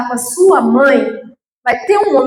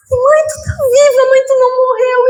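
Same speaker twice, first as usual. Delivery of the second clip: overjoyed cry; 8 semitones higher than usual. Retold or imitated. Daughter reunited with her mother, high and overjoyed. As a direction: burst out with joy through tears.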